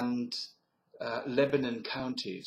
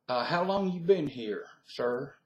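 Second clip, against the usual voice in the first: Southern US accent; 3 semitones higher than usual. Shown as a demonstration. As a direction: ask it like a curious Southerner. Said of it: "so that's my southern accent".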